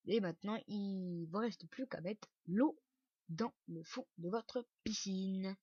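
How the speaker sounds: ordinary talk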